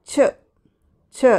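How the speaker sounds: ച said twice, crisp and isolated; teacher showing the digraph sound. Shown as a demonstration. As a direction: model the ch sound ച for the class. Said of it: "ഈ ഒരു പ്രൊനൗൺസിയേഷനാണ് വരുന്നത്".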